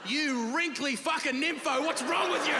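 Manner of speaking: hammed-up horrified shriek at granny; mock outraged yell. Yelling at grandma in retold scene.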